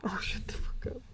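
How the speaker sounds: whispered retort. Real, not acted. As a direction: whisper it as a teasing comeback. Teasing retort during mock hitting squabble.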